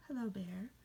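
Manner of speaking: normal talking voice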